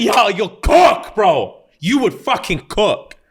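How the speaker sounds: high, slow, shouted hype at a friend; excited hype. Real, not acted. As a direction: cheer him on with a drawn-out shout. Hyping up a friend.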